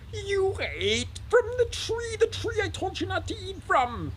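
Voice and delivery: high, strained, outraged accusation; God losing his temper; furious. Acted. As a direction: roar it slowly in a soaring pitch. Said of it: "like, furious".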